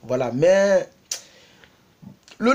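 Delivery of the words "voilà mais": ordinary delivery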